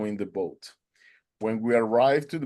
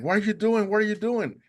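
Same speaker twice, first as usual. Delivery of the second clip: high-pitched mock scream; retold screaming; 10 semitones higher than usual. Boat owner screaming at the swimmer, high mock yell. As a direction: imitate a man yelling in disbelief. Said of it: "the guy started screaming at me".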